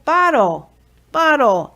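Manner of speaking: "Bottle" said high and loud, clearly; name "Bottle" for the baby, bright and loud; clear labelling. Shown as a demonstration. Parent labelling the bottle for a baby, high and loud. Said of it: "you just label".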